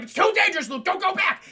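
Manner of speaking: urgent warning. Retold or imitated. Ben warning Luke, acted.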